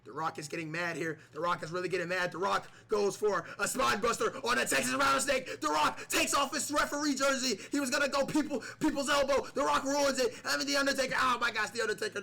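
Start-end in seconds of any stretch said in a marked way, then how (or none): 2.3-12.2 high, loud excited call; raised, loud wrestling play-by-play; hyped commentary. Pretend. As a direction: call it like the crowd is going wild. Commentator hyped as Rock attacks.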